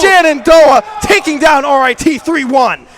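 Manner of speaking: loud, high triumphant call; excited victory call. Real, not acted. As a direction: announce the win with hype. Caster calling the series win.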